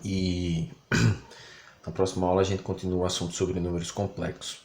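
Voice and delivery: normal talking voice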